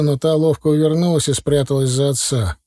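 ordinary delivery

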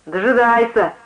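mildly mocking comeback; mocking retort. A narrator's reading. Drunk answering the preacher, mildly mocking. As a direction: answer back with a touch of scorn. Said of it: "огрызнулся он с насмешкой".

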